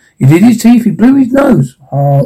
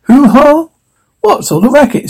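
startled cry; 10 semitones higher than usual. A narrator's reading. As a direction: shout out in alarm at the noise. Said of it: "Cindy cried".

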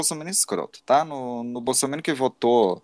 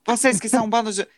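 higher, faster huffy protest; mock-indignant young radical; mock indignant retort; 8 semitones higher, faster than usual. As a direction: play your younger self snapping back. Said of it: "Eu falava".

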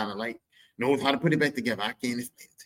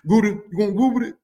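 higher, louder, mangled Google; mock dad; 9 semitones higher, 9 dB louder than usual. Dad begging son to Google, hammed up. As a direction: ham up the old man's confusion.

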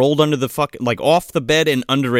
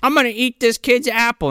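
mock bully kid; 7 semitones higher than usual. Acts bully kid stealing food.

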